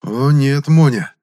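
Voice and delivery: стервозно-нравоучительно, высоко и громко; поучай со злорадством; злорадно. A narrator's reading. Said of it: "злорадно ответил я стервозно-нравоучительным голосом". (стервозно-нравоучительный голос)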